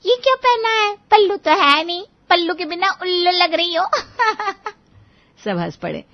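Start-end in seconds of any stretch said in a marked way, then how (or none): 0.0-3.9 high-pitched, loud mimicry of a scolding mother-in-law; mocking mother-in-law imitation. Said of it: "mummy ने कल नई dress देखकर कहा". 3.9-4.7 high, giggly burst of laughter; acted laugh. Laughing at her own mimicry.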